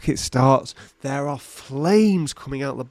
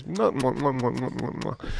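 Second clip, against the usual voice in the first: kiss sounds; 6 dB quieter than usual. Acted. Blowing kisses to listener.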